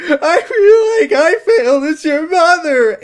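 loud, high-pitched mock wail; hammed-up sobbing. Mother admits failure to her daughter, sobbing loudly. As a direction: bawl it out at full volume. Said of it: "Anne sobbed as well".

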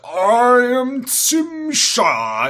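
slow, high, hammed-up proclamation; alien villain. Alien introducing himself, slow over-the-top villain voice. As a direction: draw every word out grandly. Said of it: "a doctor being goofy and zany situation".

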